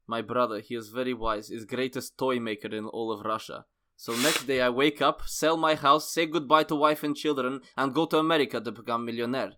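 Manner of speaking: put-on earnest Russian-accented storytelling. Host reading Nikolai's life story, Russian accent. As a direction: tell the tale in broken Russian English. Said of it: "a bit of Russian in it".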